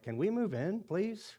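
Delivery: high, pleading mock whine; exasperated plea. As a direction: beg the late home builder, half joking. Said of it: "I'm like".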